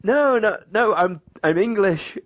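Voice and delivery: high, retold insistence; insistent denial. Repeats protest at being called Dutch.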